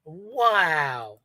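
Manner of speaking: drawn-out mock wow; unaware wow. Imitating her wowing without even knowing it, drawn-out. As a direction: stretch out a dazed wow. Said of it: "she doesn't even know what she's fucking wowing".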